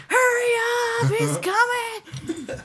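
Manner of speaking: high, slow panicky yell; panicked shout. Retold or imitated. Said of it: "and I'm like".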